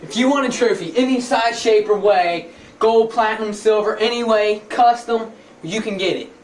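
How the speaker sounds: loud, high, pushy pitch; hard sell ad. Pretend. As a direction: shout the deal at the viewer. Shouted trophy commercial pitch.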